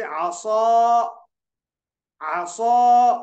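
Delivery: आसा with a rounded, long held "aw" ending; full heavy alif. Shown as a demonstration. Teacher demonstrating pur to students, आसा long and heavy. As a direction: hold the last vowel of आसा full and thick. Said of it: "पुर होगा, जैसे".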